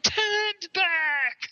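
high, drawn-out cry of "back"; cracked alter-ego yell. Retold or imitated. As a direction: imitate a teasing high squawk. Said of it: "I would crack my voice".

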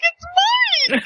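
very high-pitched mock squeal; excited recognition. Listener recalls spotting Mike, high excited squeal. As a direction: shoot the pitch right up.

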